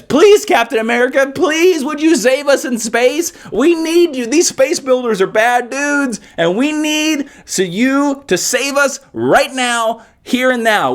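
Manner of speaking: high, slow mock plea for rescue; pleading citizen. Townsfolk begging Captain America to save them, high mock plea. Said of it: "you're just like".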